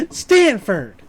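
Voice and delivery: loud acted-out name call; imitating a villain's loud greeting; mock confrontation. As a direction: call out a rival's name, hammed-up. Acts out Gideon confronting Stan.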